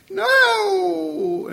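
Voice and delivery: very high, loud shriek of no; one defiant toddler shriek; child still screeching. Retold or imitated. Boy still refusing while father pacifies, shrill. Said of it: "he was still saying".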